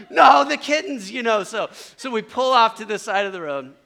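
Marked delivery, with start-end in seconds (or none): 0.1-1.1 high, loud pleading cry; mock-frantic insistence; distressed wail. Friends pleading for the kittens after the crash, distressed wail.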